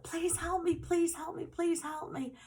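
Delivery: high-pitched repeated begging, reenacted; tearful plea. Girl banging on a porch door, high frantic pleading. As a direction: plead over and over, pitched well above normal. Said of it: "I'm really, really crying".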